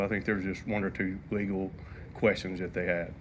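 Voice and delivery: hoarse, worn-out sick voice; losing voice. Real, not acted. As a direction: speak with a voice that's nearly gone. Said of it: "I'm losing my voice".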